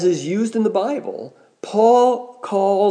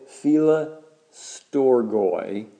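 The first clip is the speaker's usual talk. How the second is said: Philostorgoi drawn out very slowly, part by part; 4 semitones lower, 3 dB quieter, much slower than usual. Shown as a demonstration. Preacher teaching the congregation a Greek word, said slowly. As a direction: stretch out Philostorgoi slowly, ending on a rise. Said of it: "Say it slowly".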